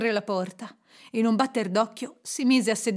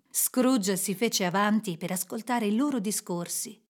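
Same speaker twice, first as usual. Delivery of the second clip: ordinary delivery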